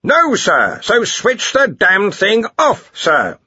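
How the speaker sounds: high, slow, emphatic command; exasperated order. A narrator's reading. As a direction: order a subordinate, word by word. Archchancellor barks an order.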